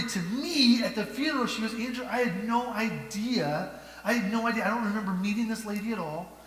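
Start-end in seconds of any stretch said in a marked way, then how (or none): 1.7-6.4 amazed retelling. Retold or imitated.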